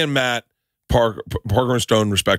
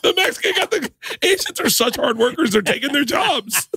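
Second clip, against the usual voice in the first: laughing; 13 semitones higher than usual. Real, not acted. Joking on while cracking up.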